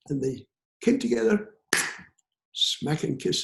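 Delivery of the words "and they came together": usual speaking voice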